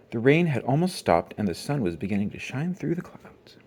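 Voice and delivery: plain reading voice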